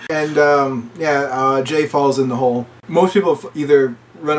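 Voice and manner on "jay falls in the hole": usual speaking voice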